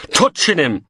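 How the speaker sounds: ordinary delivery, nothing marked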